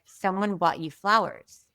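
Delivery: ordinary talk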